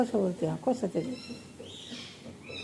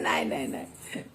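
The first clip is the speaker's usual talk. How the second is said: mock scared whisper; 6 dB quieter than usual. Pretend fear continues, whispered.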